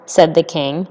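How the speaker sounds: ordinary delivery, nothing marked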